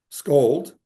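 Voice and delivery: ordinary talk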